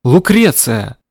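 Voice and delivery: loud call upward; barking call. Acted. Grandmother calls her granddaughter upstairs. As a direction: bark the name up to the window. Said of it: "гаркнула".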